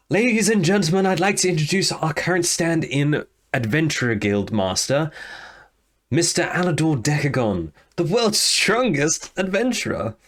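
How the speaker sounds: high, grand herald voice; announcing. Acted. Lorelei presents Alador to the guild hall, high and grand. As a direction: proudly present him to the crowd.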